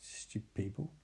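barely audible, dropped way down; muttered aside. Retold or imitated. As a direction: mutter it under your breath. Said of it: "And Cal's like".

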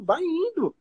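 usual speaking voice

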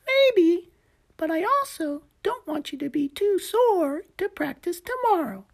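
high little gecko explaining. Acted. Gecko voiced by narrator.

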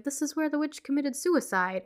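lightly mock Ed's Canadian delivery; half-hearted Canadian accent. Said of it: "it's the word suicide that sounds Canadian".

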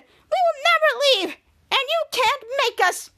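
high, put-on creature voice; high-pitched character defiance; defiant mole person. Mole person defies Henry.

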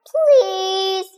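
high, sweet begging please; cute pleading. Acted. Little Emma begging to be in the story, high and cute. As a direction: beg sweetly like a little kid. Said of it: "with really cute puppy eyes".

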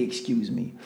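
"excuse me" said soft and low, overly meek; overly polite. Shown as a demonstration. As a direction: murmur "excuse me" trying to seem harmless. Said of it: "Like, overly".

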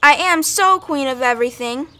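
put-on defiant kid's parting shout; hollering. A narrator's reading. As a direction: holler back, leaning on "so". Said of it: "hollering over her shoulder".

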